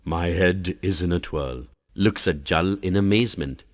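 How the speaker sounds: usual speaking voice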